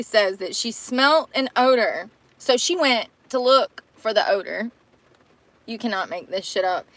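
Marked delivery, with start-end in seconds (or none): none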